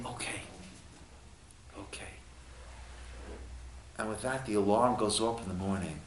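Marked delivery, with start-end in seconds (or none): 0.1-2.1 barely audible, hushed okay; near-whispered granting of a plea; compassionate assent. Acted. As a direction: relent very softly, as a merciful court. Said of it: "in their compassion, they say".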